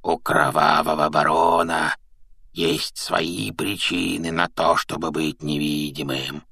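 slow, rasping ghostly baron; husky, menacing stage whisper; hoarse whisper. A narrator's reading. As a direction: rasp it slowly under your breath. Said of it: "хриплым шёпотом".

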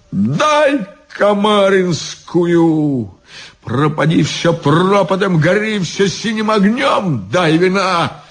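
high-pitched anguished abandon; reckless drunken demand. A narrator's reading. Drunk guard demanding music and wine, reckless wail. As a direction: throw it out high, to hell with everything.

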